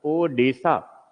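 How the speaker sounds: ओडिशा drawn out slowly as "Odesa"; British mispronunciation. Shown as a demonstration. Teacher mimics how the British said the name. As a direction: say ओडिशा slowly, like a British speaker who can't say it. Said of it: "British लोग बोल नहीं पाते थे".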